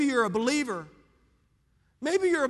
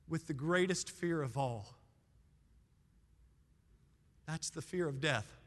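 tender altar appeal; 5 semitones lower, 6 dB quieter than usual. Acted. Pastor pleading gently with people who fear death, soft and sincere. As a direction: lower the voice and speak heart to heart.

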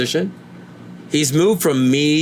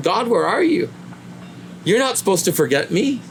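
higher, pleading cry of someone in pain; anguished plea to God; 6 semitones higher than usual. Retold or imitated. A believer in pain and loss cries out to God, pleading. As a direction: call out to an absent God, aching. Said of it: "at times you thought".

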